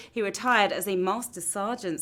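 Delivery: ordinary delivery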